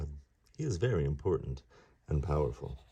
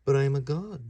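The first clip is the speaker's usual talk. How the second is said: put-on higher, louder boastful kid voice; proud young god; 9 semitones higher, 6 dB louder than usual. Ganesha brushing off Mouse, a boastful higher voice. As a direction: boast it like a cocky boy. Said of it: "said Ganesha".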